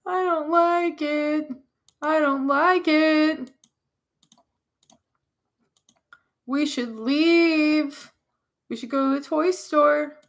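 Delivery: put-on high, slow, trembling little kid; scared child. A scared child asking to leave the pet store, high and slow. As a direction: draw each word out like a frightened kid.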